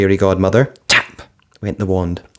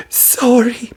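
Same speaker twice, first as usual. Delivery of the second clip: weeping. A narrator's reading. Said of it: "wept Cinderella".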